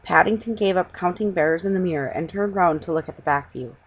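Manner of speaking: plain reading voice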